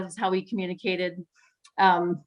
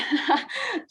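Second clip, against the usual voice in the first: light laugh. Real, not acted. Laughs at own funny story.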